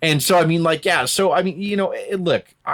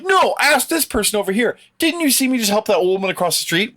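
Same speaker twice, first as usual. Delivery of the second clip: high, indignant mock self-defence; mock protest; 9 semitones higher than usual. Podcaster acting out an accused bully calling a witness, mock protest. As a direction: imitate an accused man pleading his good deeds. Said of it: "when someone accuses him of being".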